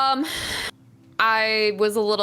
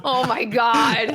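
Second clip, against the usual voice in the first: laughing; 3 semitones higher, much slower than usual. Real, not acted. Groaning at pun.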